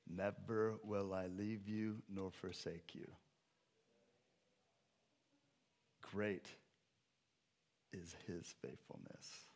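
low, slow, very soft assurance; hushed, solemn promise. Real, not acted. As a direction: let each word sink in, almost to nothing. Solemn quoting of God's promise.

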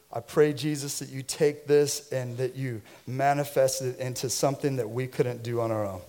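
soft, low pastoral prayer. Real, not acted. Pastor praying over the offering, soft and low. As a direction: pray gently over the gift.

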